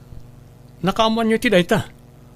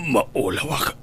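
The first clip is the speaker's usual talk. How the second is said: lower, softer dying voice; weak and faint; 5 semitones lower, 7 dB quieter, slower than usual. Acted. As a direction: fade out as if collapsing. Poisoned father collapsing.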